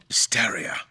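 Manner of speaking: a single hushed word; grim whisper. Acted. King telling the doctor how the soldiers died, whispered. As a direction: breathe it like a dark secret.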